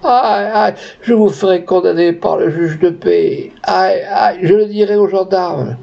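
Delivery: theatrical lament between sobs; sobbing wail. A narrator's reading. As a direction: moan and protest in a high tearful voice. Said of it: "entre des soupirs et des sanglots".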